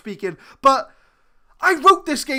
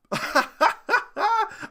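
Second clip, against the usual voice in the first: high giggle; 10 semitones higher than usual. Real, not acted. Disbelieving laugh at the scoreline.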